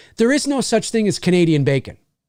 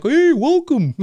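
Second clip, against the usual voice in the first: very high cartoon Canadian greeting; exaggerated, pitched-up Canadian accent; mock Canadian accent; 10 semitones higher, slower than usual. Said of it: "it's not like the Canadian accent".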